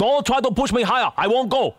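indignant protest. Pretend. Prank caller quarrels over price.

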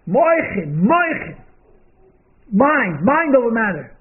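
a hint of high, loud, impassioned pleading; passionate last words. Dying Rebbe answers his son, lightly impassioned and loud. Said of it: "so much emotion, so much passion".

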